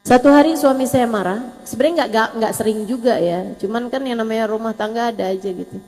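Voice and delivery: ordinary talk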